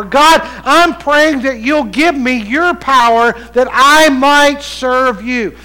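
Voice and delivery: loud, high plea to God; preached prayer. Acted. Preacher voicing Elisha asking God for power, impassioned preaching. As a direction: plead with full pulpit force.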